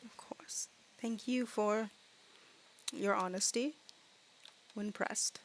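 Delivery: very soft, breathy dragon voice. Acted. Dragon thanks the riders for honesty, softly. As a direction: answer gently in a near whisper. Game master answers as the dragon.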